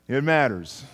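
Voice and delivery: normal talking voice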